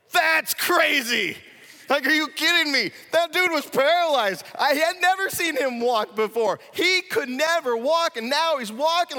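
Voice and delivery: loud, high, astonished crowd voice; stunned onlooker yelling in wonder; amazed shout. Retold or imitated. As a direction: shout it like you can't believe your eyes. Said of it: "No, it's more like".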